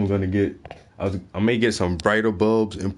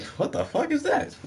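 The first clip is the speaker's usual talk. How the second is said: very high, incredulous squeak; acted high-pitched confusion; mock bafflement; 4 dB quieter than usual. Acts baffled reaction to the accents.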